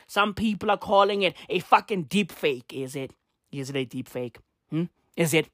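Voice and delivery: ordinary talk, nothing marked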